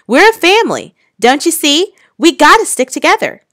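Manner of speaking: high, loud desperate plea; pleading shout. Acted. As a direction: keep shouting, begging them to stay together. Boy refuses to be separated.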